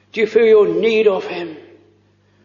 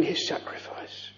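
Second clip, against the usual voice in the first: breathy awed whisper; 7 semitones lower, 17 dB quieter than usual. Real, not acted. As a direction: hush it as if in awe. Awed hush over Christ's sacrifice.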